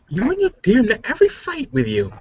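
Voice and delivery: ordinary talk, nothing marked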